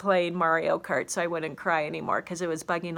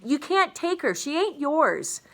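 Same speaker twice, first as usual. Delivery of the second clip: screaming; 8 semitones higher, 3 dB louder than usual. Acted. Bryce stopping the father from taking her, a desperate high scream. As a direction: refuse to let her go. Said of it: "Bryce screamed".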